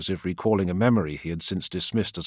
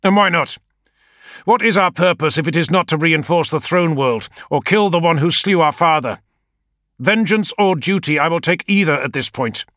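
much higher and louder, fierce; defiant outburst; 10 semitones higher, 8 dB louder than usual. A narrator's reading. Leader defending vengeance to his adviser, defiantly loud. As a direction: hurl the question back.

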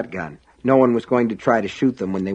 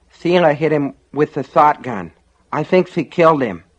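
higher, mushy dazed voice; 5 semitones higher, 3 dB louder than usual. A narrator's reading. Injured John explaining to his rescuers, mushy and dazed. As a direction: talk thickly through a swollen lip and missing tooth. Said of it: "John said in a mushy, dazed voice".

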